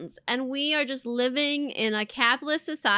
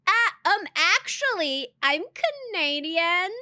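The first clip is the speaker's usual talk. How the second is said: higher-pitched, hesitant "um, actually" correction; mock correcting; 5 semitones higher than usual. Streamer correcting those who assume American, mock correcting. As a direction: politely set them straight, pitched up. Acting out her correction to others.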